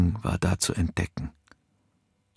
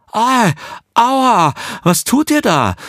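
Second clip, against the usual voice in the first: high, loud outraged yelp of pain; indignant cry; 8 dB louder than usual. A narrator's reading. Nicolina, scalded by the nurses, cries out indignantly. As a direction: shriek out in shocked protest. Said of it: "rief Nicolina entrüstet".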